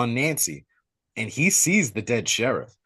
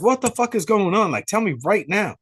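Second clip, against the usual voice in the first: higher, agitated retold outburst; mock frantic demand; 8 semitones higher than usual. Nancy demanding answers at the cruiser, agitated. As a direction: act out a confused, urgent demand.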